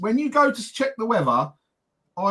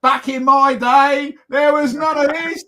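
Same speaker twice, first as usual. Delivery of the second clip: hammed-up old-timer, higher and louder, slow; slow, loud mock sermon of nostalgia; mock preaching; 5 semitones higher, 6 dB louder than usual. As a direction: preach it like a grumpy elder. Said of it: "you'll be preaching".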